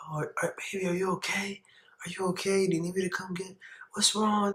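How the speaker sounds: high, soft concerned mother voice; worried mom. Retold or imitated. Mom comforting son on phone, soft high worry. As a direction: fuss over your baby in a small high voice.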